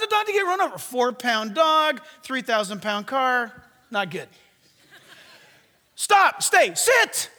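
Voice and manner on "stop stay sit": high loud dog commands. Retold or imitated. Preacher repeating his loud commands to the dog. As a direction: call out firm orders across the street. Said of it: "Strong words ... loud words".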